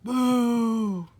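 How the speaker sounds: loud spooky boo; ghost boo. A narrator's reading. Grubble as a ghost scaring Hugly, loud boo. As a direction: jump out and yell boo. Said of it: "yelled a ghost".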